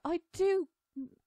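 put-on high, loud girlish outburst; indignant little-girl protest; snapping. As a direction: snap back in a high child's voice, stretching the first words. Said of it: "Alice snapped at the Hatter".

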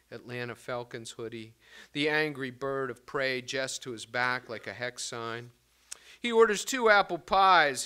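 declaimed poem, loud openings; poetry reading. Acted. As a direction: give each image its own weight. Reads his poem aloud.

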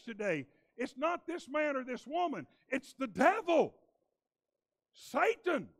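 high, loud preacher's build, rising to fervour; fiery preaching. Acted. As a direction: preach it out to the whole congregation. Preaching at full force.

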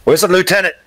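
high, loud challenge; sharp demand. Acted. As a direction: demand an answer from an intruder. Startled soldier challenges the intruder.

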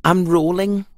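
a light hint of a hopeful pumpkin; Pumpkin's voice. A narrator's reading. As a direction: ask shyly like a little pumpkin. Pumpkin answers.

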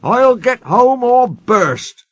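high, loud, slow vow; determined, emphatic declaration; brave promise. A narrator's reading. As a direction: vow it with all your effort. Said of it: "he promised bravely".

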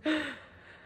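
sharp, high intake of breath; excited gasp. Real, not acted. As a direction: gasp in nervous anticipation. About to unwrap the doll.

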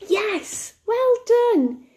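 high-pitched, encouraging cheer; delighted praise. Pretend. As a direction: celebrate a child's right answer. Teacher praises children's correct answer.

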